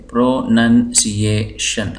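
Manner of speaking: प्रोनाउंसिएशन slowly as pro - nan - siye - shan; syllable by syllable. Shown as a demonstration. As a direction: separate each piece of प्रोनाउंसिएशन for memorising. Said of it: "इस तरह याद कर लें".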